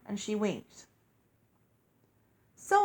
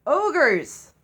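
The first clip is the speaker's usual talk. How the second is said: loud, high alarmed yell; Ogres! yelled in panic; coachman yelling; 6 semitones higher, 8 dB louder than usual. A narrator's reading. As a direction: shout the warning at the horses. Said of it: "the coachman yelled".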